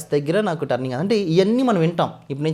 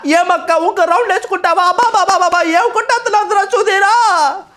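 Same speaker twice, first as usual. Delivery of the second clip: much higher, louder comic film voice; Saptagiri voice impression; 9 dB louder than usual. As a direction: crank it way up high and loud, playing the comedian in a ghost scene. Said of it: "ఈ రెండు modulation మాట్లాడితే ఎలా ఉంటుంది".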